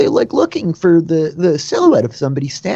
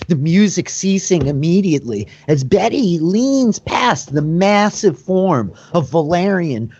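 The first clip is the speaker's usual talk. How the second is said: ordinary delivery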